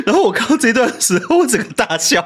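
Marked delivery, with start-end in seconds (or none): none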